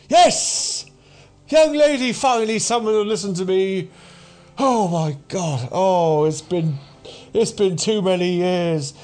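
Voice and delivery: put-on high-pitched, frantic old ranter; overwrought doomsayer. Dishevelled man finally gets someone to listen, overwrought ranting. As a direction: pour it out to your first listener in years.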